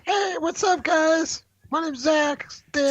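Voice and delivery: very high, squeaky cartoon voice; squeaky tiny voice. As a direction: squeak it like a tiny talking penis. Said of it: "you would talk like this".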